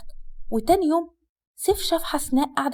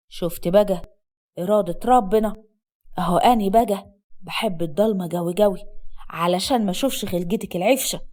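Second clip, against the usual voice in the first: low-pitched Upper Egyptian husband; scornful husband; 5 semitones lower than usual. Acted. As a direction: drop low and taunt the wife. Voices the scornful husband in the story. (زوج صعيدي ساخر بصوت واطي)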